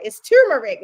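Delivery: "turmeric" punched loudly, not starting like "tomb"; corrected pronunciation. Shown as a demonstration. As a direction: insist loudly on the "tur" in "turmeric". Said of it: "I'm not saying tomb".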